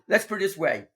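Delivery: usual speaking voice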